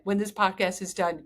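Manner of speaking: ordinary talk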